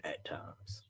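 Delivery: whispered aside, barely voiced. Real, not acted. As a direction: drop to a breathy whisper as if the brother can't hear. Teasing aside about his brother.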